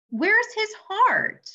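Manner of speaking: high, slow, stunned disbelief; shocked gasp question. Acted. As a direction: stretch it out in pure shock. Shocked at the X-ray.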